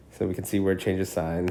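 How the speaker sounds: ordinary talk, nothing marked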